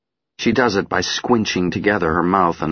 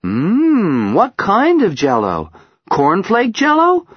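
high playful teasing; 14 semitones higher, 6 dB louder, slower than usual. Acted. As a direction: tease the child gently. Mother teasing child.

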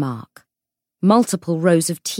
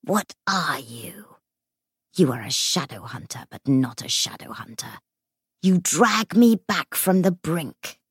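seething shrieking spirit. Acted. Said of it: "The ghost hissed".

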